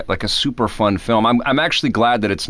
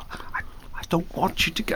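breathy whispered Michael Caine; high, breathy and hushed; Michael Caine impression; 9 semitones higher, 10 dB quieter than usual. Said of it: "he's got that breathy voice".

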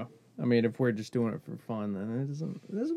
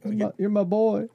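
bro voice; 9 semitones higher, 4 dB louder than usual. Retold or imitated. Mimicking buddy greeting.

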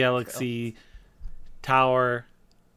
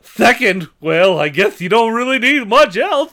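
Ed Wynn; 6 dB louder than usual. Acted. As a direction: do a tittering Ed Wynn. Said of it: "I like Ed Wynn as Dane Cook".

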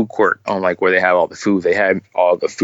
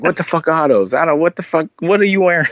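higher-pitched, imitated ribbing; mock disbelief; 9 semitones higher than usual. Retelling a man cracking jokes on her shoes, mock-incredulous imitation. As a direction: act out someone roasting a person's outfit.

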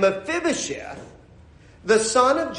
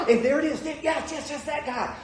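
gasping, high, hushed mock excitement; excited; 7 semitones higher, 4 dB quieter than usual. Churchgoer spotting a neighbor at the curb, hushed excitement. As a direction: spot your target with high, soft eagerness. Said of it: "Oh, you get so excited".

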